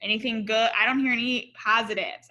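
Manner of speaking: usual speaking voice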